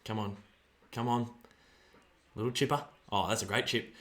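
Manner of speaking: normal talking voice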